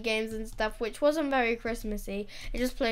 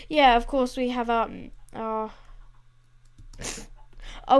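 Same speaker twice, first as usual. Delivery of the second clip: usual speaking voice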